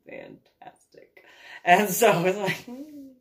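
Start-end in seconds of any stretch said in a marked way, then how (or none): 0.1-1.2 breathed, very soft fantastic; whispered sarcasm. Retold or imitated. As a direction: whisper it with deadpan irony. Sarcastic thought after dread.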